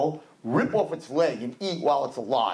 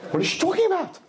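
baffled husband; 11 semitones higher, faster than usual. Retold or imitated. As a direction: act shocked at the accusation. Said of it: "same reaction".

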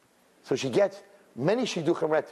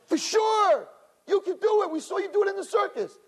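crowd shouting. Retold or imitated. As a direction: belt it out high like a crowd that's sure.

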